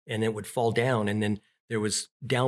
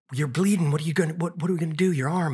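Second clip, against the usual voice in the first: high, loud, frantic worry; panicked child; 6 semitones higher, 3 dB louder, faster than usual. Retold or imitated. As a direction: cry out in alarm at the wound. Child panicking over wound.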